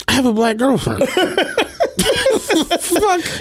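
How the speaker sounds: can't keep a straight face through the line; laughing comeback. Retold or imitated. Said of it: "You're doing a lot right now".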